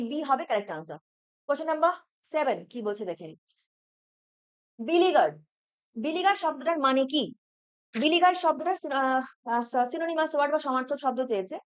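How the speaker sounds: ordinary talk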